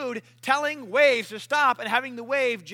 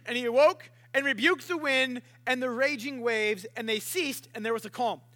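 normal talking voice

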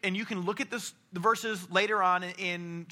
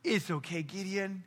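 soft and tender, quieter; a tender, hushed God; gentle reassurance; 6 dB quieter than usual. Acted. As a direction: comfort him softly. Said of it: "loving and encouraging and to be like".

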